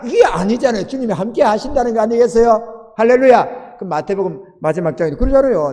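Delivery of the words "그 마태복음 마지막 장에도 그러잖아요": ordinary delivery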